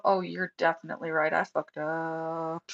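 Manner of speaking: retold sheepish admission. Retold admission of own mistake.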